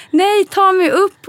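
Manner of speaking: high, loud, drawn-out plea; retold scream. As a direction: act out crying out in protest. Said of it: "jag skrek där".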